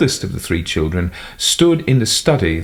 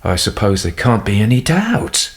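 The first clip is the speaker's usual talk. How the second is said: ordinary delivery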